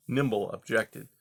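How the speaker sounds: ordinary delivery, nothing marked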